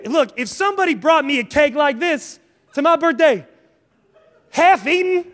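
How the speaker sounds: ordinary talk, nothing marked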